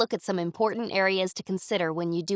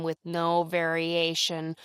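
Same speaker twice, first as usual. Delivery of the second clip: slow, droning monotone; flat monotone demo; 2 semitones lower, slower than usual. Shown as a demonstration. Teacher mimicking the droning professor, flat monotone. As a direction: drone it out on one note. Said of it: "spoke at the same pitch".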